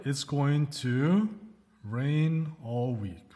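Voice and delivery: slow, clearly spaced teacher model line; slow, careful classroom dictation; slow model sentence. Shown as a demonstration. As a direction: spell it out slowly for learners, each word given room. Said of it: "So what's the situation here?".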